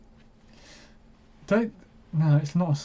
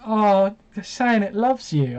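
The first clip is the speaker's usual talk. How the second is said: slow, lightly higher mock girlfriend voice; mimicking girlfriend; 5 semitones higher, slower than usual. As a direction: drag it out like a doting cat lover. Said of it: "she'll go".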